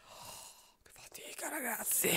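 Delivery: a worn-out whisper to the viewers; tired whisper. Real, not acted. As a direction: sigh it out in a whisper.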